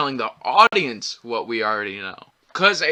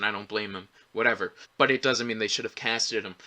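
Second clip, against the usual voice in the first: usual speaking voice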